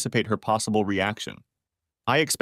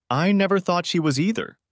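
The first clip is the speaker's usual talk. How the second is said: strained, higher-pitched, tight-throated; wheezing; 8 semitones higher than usual. A narrator's reading. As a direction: push it out through a painful throat. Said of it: "I wheezed as the pain in my throat intensified".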